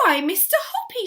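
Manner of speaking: high, loud surprised exclaim; delighted cry. A narrator's reading. Missus Silver answering the proposal, high and loud. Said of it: "she cried".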